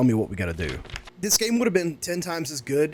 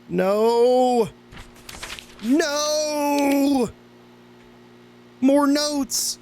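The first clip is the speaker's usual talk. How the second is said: anguished yell; 13 semitones higher, much slower than usual. Real, not acted. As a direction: cry out in hammed-up despair. Mock despair at more notes.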